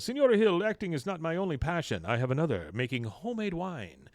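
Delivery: woo her with a put-on Latin lilt; suave Latin actor. Voicing Mexican actor in recap.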